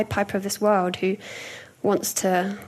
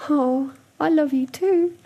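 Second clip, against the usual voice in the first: slow, higher, tender reply; melted tenderness; 6 semitones higher, slower than usual. Retold or imitated. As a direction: let the whole world stop as you say it. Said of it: "I'm like".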